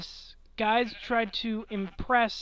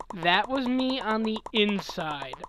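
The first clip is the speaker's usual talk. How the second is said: ordinary talk, nothing marked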